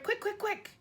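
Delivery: high, rushed calls; don't lose the dream; urgent hurry. Acted. Giant hurries for another jar.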